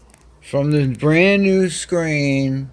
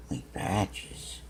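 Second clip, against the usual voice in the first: whisper; 4 semitones lower, 11 dB quieter than usual. Real, not acted. Whispering to the cat.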